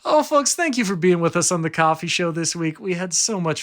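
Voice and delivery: high, bright announcer greeting; hammed-up show host opening; podcast host sign-off.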